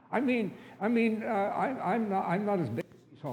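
high, stammering objection; nervous protest. Acted. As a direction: stumble anxiously through an excuse. Nervous son objects to mother's plan.

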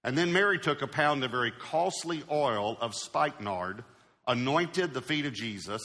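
soft, measured Bible reading; hushed, reverent reading of scripture. Acted. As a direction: read the passage quietly to the church. Reads scripture passage.

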